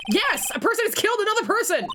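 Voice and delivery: high, fast, hammed-up exclamation; exasperated outburst. Lawyer explains murder to airhead, exasperated. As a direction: spell it out in loud disbelief.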